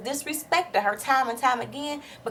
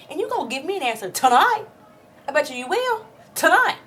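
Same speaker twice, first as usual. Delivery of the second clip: higher, much louder demand; pretend furious yell; 5 semitones higher, 4 dB louder than usual. Vlogger pretending to stop Apollo leaving, furious yell. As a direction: stop him in his tracks.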